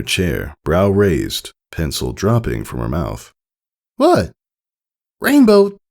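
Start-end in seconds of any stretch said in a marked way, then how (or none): 4.0-4.3 surprised. Acted. Apple Bloom asks, surprised. 5.2-5.8 loud high shout; high loud filly call; excited call. Acted. Scootaloo calls over bell.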